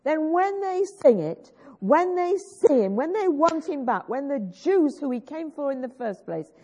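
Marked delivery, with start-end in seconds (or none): none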